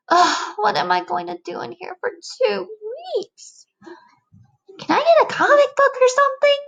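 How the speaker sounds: a bored bug stuck inside; fidgety squeaky complaints; whining caterpillar. Acted.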